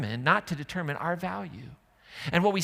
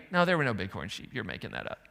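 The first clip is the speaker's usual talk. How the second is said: mock denial; 7 semitones lower, faster than usual. Doubter in the car scoffing at the others. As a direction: brush them off as liars. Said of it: "your response could be".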